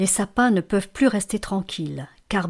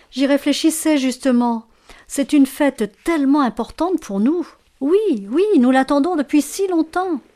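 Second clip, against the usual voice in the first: higher, louder eager chatter; excited anticipation; 6 semitones higher, 5 dB louder than usual. A narrator's reading. Fir trees awaiting Christmas, excited. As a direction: gush with eagerness for the big day.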